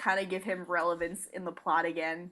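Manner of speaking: normal talking voice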